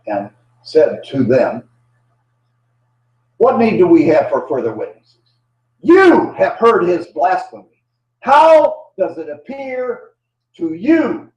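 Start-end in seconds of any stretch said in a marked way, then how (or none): 3.4-11.3 outraged denunciation. Acted. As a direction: accuse him before the whole council, hammering each phrase. Chief priest denounces Jesus to the council.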